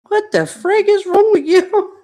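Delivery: husband's bewildered laughing outburst; laughing disbelief. Retold or imitated. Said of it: "And started laughing".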